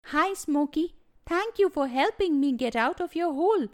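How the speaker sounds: a small creature thanking gently; little bunny. Acted. Bunny thanks Smokey for helping him, lightly voiced little bunny.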